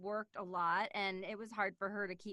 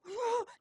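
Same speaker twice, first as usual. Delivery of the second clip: sharp high excited gasp; 12 semitones higher, 7 dB louder than usual. Shown as a demonstration. Showing how she reacts when excited, a high gasp. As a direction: gasp like a thrilled kid. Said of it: "I would just, like, go".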